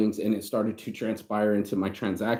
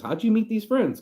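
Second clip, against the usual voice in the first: much higher, mock-curious voice of a friend; incredulous friend; 12 semitones higher, 3 dB louder than usual. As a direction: play the friend, pitched way up and a touch louder. Friend asks about his friends.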